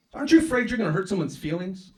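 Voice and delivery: high, loud prissy ex-wife voice; mock ex-wife. Ex-wife scolding comedian after his set, high loud put-on voice.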